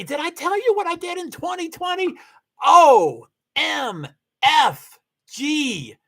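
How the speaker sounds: normal talking voice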